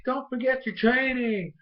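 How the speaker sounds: high, drawn-out call of a reminder; mock an urging shout in a high, slow voice; Serena calling out. Serena reminds Ash mid-battle, high mock call. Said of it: "Serena's like".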